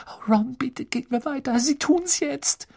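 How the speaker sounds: sehr hohe, langsame Bitte; Hermine flehend. A narrator's reading. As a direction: fleh ihn hoch und gedehnt an. Hermine pleading. (Hermine fleht Ron an weiterzugehen)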